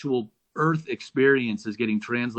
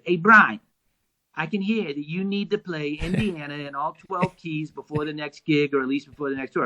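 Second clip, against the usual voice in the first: Johnny; 4 semitones higher than usual. Retold or imitated. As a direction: mimic a bandmate giving orders, high at first and settling toward the end. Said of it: "Johnny said".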